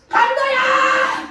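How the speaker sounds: high, drawn-out alarm call; cry for help. Shown as a demonstration. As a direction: cry out for help against a robber. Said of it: "소리를 질러야".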